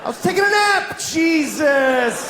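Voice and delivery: fish woken from a nap, yelling in outrage; indignant fish. Acted. Flushed fish protesting from the toilet, indignant yell. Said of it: "it looks like he goes".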